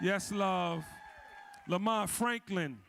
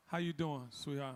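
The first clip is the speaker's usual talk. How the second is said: low soft flirty murmur, lower and softer; flirty greeting; 5 semitones lower, 6 dB quieter than usual. Real, not acted. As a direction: drop it low and soft, like a come-on. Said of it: "I didn't mean to say it like that".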